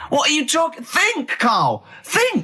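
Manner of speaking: exasperated rant. Real, not acted. Ranting at absurd story.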